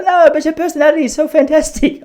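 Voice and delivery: high put-on Hungarian accent. Old woman to the tourist, high mock Hungarian accent. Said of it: "I've gone Hungarian".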